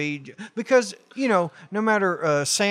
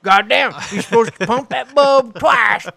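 loud, higher-pitched scolding father; angry dad; 6 semitones higher, 5 dB louder than usual. Retold or imitated. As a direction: yell instructions like an impatient dad. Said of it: "an asshole like my dad".

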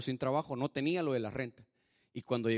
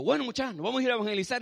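higher, louder summons, acting a housemate; rallying call; 7 semitones higher, 5 dB louder, faster than usual. Brother calls the housemates out to evangelize, rallying call. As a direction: round everyone up briskly.

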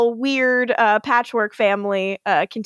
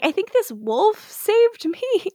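dazed high voice; 11 semitones higher, slower than usual. Retold or imitated. Haley quoted dazed.

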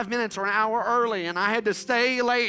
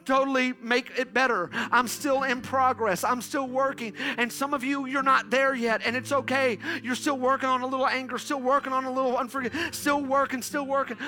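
a lightly raised sermon voice; preaching; 5 semitones higher, 4 dB louder than usual. Acted. Preacher reassuring the church it's okay to be in progress, earnest. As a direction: coax them along, steady and urging.

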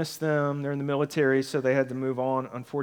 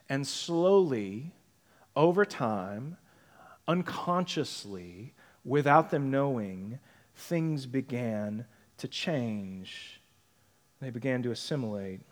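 quiet; 5 semitones lower, 7 dB quieter than usual. Real, not acted. Reflective sermon build-down.

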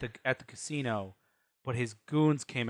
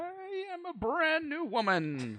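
grand declaration; 4 dB quieter, slower than usual. Acted. Player declares dramatically.

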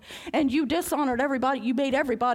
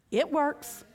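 ordinary talk, nothing marked

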